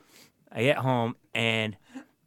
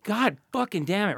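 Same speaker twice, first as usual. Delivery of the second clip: 1 retold exasperation; 9 semitones higher than usual. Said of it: "I'm like".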